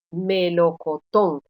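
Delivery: "melocotón" slowly, weight on the final TÓN; slow teaching demo of me-lo-co-TÓN; stress on last syllable. Shown as a demonstration. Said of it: "yo estoy pronunciando como sílaba tónica la última".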